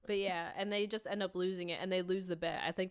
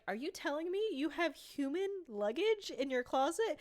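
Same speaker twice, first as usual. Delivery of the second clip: higher, lightly voiced Dee, aghast; Dee incredulous; 9 semitones higher than usual. Dee questioning Dennis about human luggage, lightly mocked. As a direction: ask it like she's horrified but unsure. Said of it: "And Dee is like".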